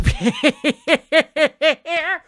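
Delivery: high staccato cackle; long rapid burst of high laughter; cackling. Real, not acted. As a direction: crack up in quick, even bursts. Laughs at his own trolling.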